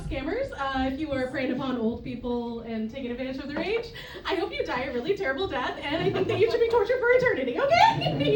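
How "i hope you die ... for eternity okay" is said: fast rant climbing higher and louder; mock-furious rant. Comedian cursing scammers who prey on old people, mock outrage. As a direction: rattle off a comic curse, building in pitch.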